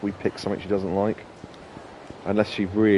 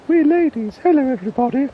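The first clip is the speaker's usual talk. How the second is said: much higher, louder falsetto lady; comic drag lady; 6 dB louder than usual. Retold or imitated. Quoting a comedy sketch in a high drag lady voice. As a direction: squawk it like a man in a dress. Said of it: "That reminds me of the".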